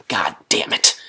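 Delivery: hushed exasperated god damn it; whispered curse. Retold or imitated. Vlogger shutting down nitpickers with a whispered curse. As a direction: mutter the curse under your breath. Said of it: "And I say".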